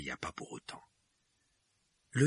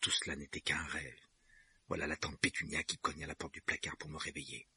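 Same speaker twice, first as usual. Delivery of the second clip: muttering; 3 semitones lower, 7 dB quieter than usual. A narrator's reading. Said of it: "marmonna-t-il".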